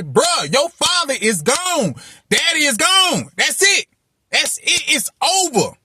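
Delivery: high, pressing mirror pep talk, half yelled; self-talk yelling at the mirror. Retold or imitated. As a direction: yell the truth at yourself in the mirror. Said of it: "I remember just screaming at myself".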